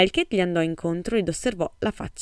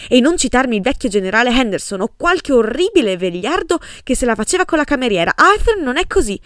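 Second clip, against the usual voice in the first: higher and louder, accusing and indignant; wife defends her husband against suspicion, accusingly and loudly; accusatory tone; 6 semitones higher, 8 dB louder than usual. A narrator's reading. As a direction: jab at her friend, rising to a near shout. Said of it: "l'aggredì con tono accusatorio".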